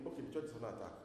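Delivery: bored shrug of a listener; indifferent shrug. Retold or imitated. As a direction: say it like it means nothing to you. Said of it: "you are going to be thinking".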